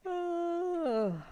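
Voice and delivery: long high yawning oh; big real yawn. Real, not acted. Instructor yawning during morning stretches, a real yawn. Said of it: "I got another one coming".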